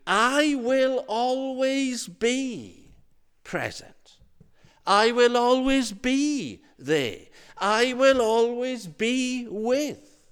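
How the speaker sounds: high, slow, drawn-out "I will always be"; emphatic promise. Acted. Preacher voices God's promise of presence, emphatic. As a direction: hold "be" long and high, word by weighty word.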